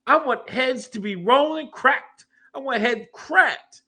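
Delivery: high-pitched, drawn-out comic outrage; hammed-up indignation, punching out each demand; mock fired-up rant. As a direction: demand a brawl in a high, worked-up voice. Mock tirade demanding congressional brawl.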